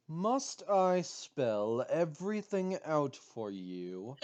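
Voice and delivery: slow, menacing villain line; supervillain voice. Shown as a demonstration. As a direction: drag it out like a supervillain. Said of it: "give her a voice like a supervillain".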